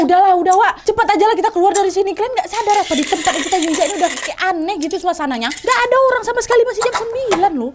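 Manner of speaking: high, urgent cry of alarm; agitated yell. Acted. Agni pressing friends to leave, agitated high yelling. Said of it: "Agni teriak".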